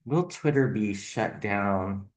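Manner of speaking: usual speaking voice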